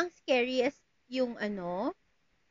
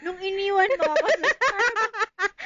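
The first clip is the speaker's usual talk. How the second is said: higher, laughing repeat of her words; laughing mock offense; 5 semitones higher than usual. Real, not acted. Friend laughing as she repeats the abandonment line. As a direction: throw her phrase back, amused.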